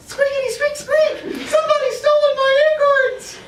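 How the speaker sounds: very high, squeaky and loud squirrel cry; panicked squirrel. Acted. Teacher voicing a robbed squirrel to students, very high squeal. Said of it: "she says".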